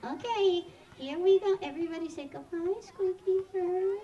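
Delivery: very high, loud squeaky voice; cutesy goodbye. Pretend. Presenter leads kids in goodbye to an animal, high cutesy voice. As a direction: coo a high, loud farewell to a cute pet.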